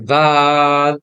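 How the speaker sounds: দাল stretched slow and held; drawn out long. Shown as a demonstration. Teacher reading দাল for the class, drawn out. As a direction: hold দাল long like the others.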